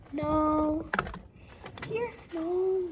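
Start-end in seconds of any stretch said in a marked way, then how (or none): none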